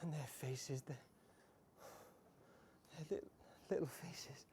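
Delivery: barely voiced, hushed words; broken whisper. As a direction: breathe it out, crushed by guilt. Remorse at the children's faces.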